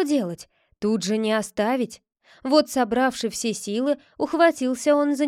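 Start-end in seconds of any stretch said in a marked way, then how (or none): none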